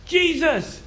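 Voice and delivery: high-pitched cry for attention; shouting. Acted. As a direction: call out as a blind man to someone passing. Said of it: "starts to start shouting".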